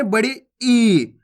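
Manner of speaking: "ई" stretched long; drawn-out vowel. Shown as a demonstration. Teacher introducing the big ee, "ई" drawn out. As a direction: hold the ee of "ई" at the end. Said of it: "खींच के पढ़ी जाती है".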